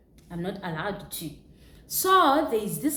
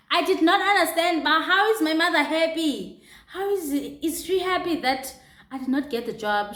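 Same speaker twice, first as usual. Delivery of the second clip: louder, higher, fast, baffled; indignant disbelief; 9 semitones higher, 7 dB louder than usual. Real, not acted. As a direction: protest in disbelief, voice up high. Baffled by mother's words.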